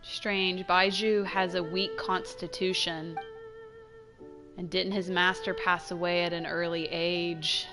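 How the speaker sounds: soft, low, musing to oneself; quiet wondering under the breath; pondering aside. Acted. Player muses on Baizhu's weak constitution, quiet pondering. Aside heard amid the argument.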